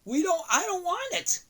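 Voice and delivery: high, pleading no; high whiny refusal. Acted. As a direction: whine it, refusing.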